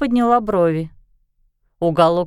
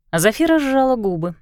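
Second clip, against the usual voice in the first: usual speaking voice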